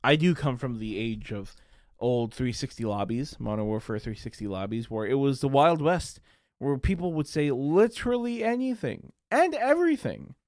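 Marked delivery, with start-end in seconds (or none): none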